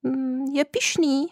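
high, timid little voice from the branches; small bird peep. A narrator's reading. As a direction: peep it out like a tiny hidden bird. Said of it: "píplo po chvíli někde ve větvích". (malý ptáček tenkým hláskem pípne z větví)

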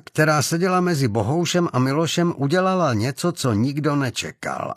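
ordinary delivery

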